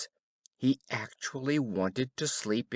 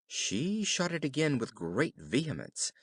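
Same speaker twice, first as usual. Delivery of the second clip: plain reading voice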